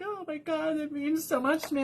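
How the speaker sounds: high, soft voice imitating a moved friend; moved gushing. Friend thanking her for noticing weight loss, high moved gushing.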